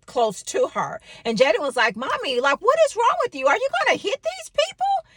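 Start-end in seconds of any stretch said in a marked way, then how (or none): 2.0-5.1 put-on high, rising child voice, alarmed; young son calling out his mother; mimicking her little boy, worried; child's voice. Son Jaden quoted in story.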